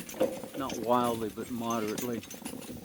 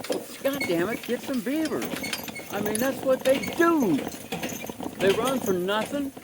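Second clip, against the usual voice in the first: exasperated rant; 9 semitones higher than usual. Real, not acted. Exasperated rant about beavers.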